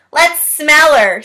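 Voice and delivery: loud, slow mocking jeer; teasing taunt. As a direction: jeer at her. Boy teasing a classmate.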